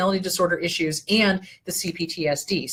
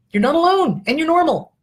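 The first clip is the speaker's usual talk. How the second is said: higher, louder insistence on alone; emphatic reassurance; 8 semitones higher, 5 dB louder than usual. Real, not acted. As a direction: lift it up and press the message home. Reassuring viewers, emphatic repeat.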